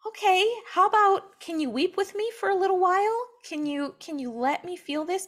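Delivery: pleading sufferer. Retold or imitated. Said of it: "It's like".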